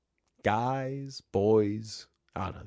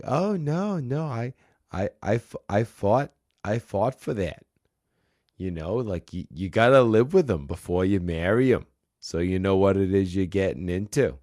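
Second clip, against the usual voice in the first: put-on Boston accent; 5 dB louder than usual. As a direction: imitate the clerk defending herself in light Boston. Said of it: "get back into the accent".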